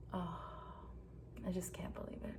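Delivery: whisper. Real, not acted. Quiet disbelief after confronting cheating husband.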